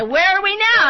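high, loud teasing call; taunting big brother; mock brother taunt. Brothers dragging him, retold quote.

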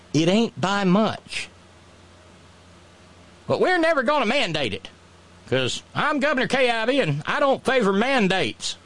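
high, hammed-up folksy voice; mock governor. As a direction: brag stubbornly as the governor. Governor voice resumes.

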